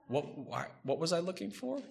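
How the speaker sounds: soft, lost muttering to oneself; puzzled murmur. Retold or imitated. Preacher mimicking someone forgetting, in a soft puzzled murmur. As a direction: mimic a forgetful moment, trailing off quiet.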